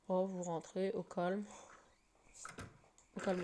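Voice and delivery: ordinary talk, nothing marked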